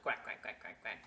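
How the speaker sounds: low, quiet duck quacks; soft duck quacking; ducks quacking thanks. Acted. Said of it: "That's probably how they say thank you".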